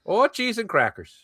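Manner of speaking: mock Minnesotan dismay; Minnesota accent. Playing along with the bit, Minnesota accent. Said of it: "the Minnesota".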